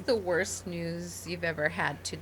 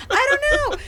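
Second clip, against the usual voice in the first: much higher, louder, laughing burst; laughing exclamation; 8 dB louder, much faster than usual. Real, not acted. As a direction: shout it out through laughter. Repeated, now laughing high and loud.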